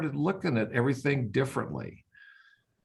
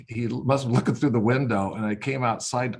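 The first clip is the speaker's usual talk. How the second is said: ordinary talk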